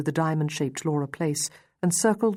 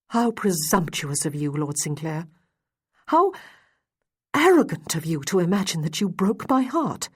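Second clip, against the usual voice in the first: rising outrage, mild at first then pushed; scornful, offended rebuke that swells; hot indignation; 2 semitones higher, 4 dB louder than usual. A narrator's reading. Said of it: "she said with hot indignation".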